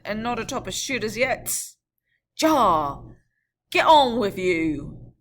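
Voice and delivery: loud, slow angry urging; vexed. Acted. Vexed coachman driving his horses, loud. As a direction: bark the horses on. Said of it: "the vexed coachman".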